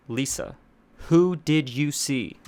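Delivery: loud, slow, stern question; stern demand. A narrator's reading. Therapist demanding an answer from his frightened patient, stern and loud. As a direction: press her hard for an answer, slowly. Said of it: "With a stern voice".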